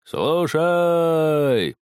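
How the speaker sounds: loud, high, drawn-out sentry call; long drawn-out shout. A narrator's reading. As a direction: stretch the call out into the dark. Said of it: "протяжно кричал Тихонов".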